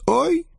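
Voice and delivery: very high-pitched little "oy"; tiny squeal of surprise; startled exhaled cry. A narrator's reading. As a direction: gasp out as the ground gives way. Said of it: "только и успела выдохнуть".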